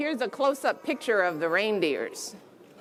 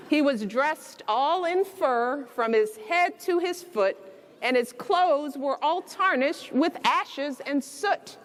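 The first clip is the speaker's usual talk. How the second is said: gentle read-aloud storytelling; bedtime story reading; 4 semitones higher, slower than usual. A narrator's reading. Reading The Night Before Christmas aloud to children, storybook voice.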